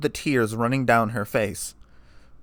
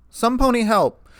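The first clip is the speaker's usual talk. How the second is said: high, loud call for help; urgent cry for help; desperate yell; 10 semitones higher, 5 dB louder than usual. A narrator's reading. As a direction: shout across the lobby. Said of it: "she yelled".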